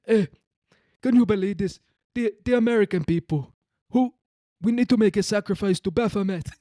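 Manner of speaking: high, loud mock Ugandan accent. Hidden American elite plotting, mock Ugandan accent. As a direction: declaim in a heavy, hammed-up African warlord accent. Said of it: "like they had Ugandan accents".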